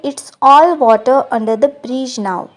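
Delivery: slow, clear English model line; slow model sentence. Shown as a demonstration. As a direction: spell it out gently for learners. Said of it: "English में कहेंगे". (धीरे और साफ़ अंग्रेज़ी वाक्य)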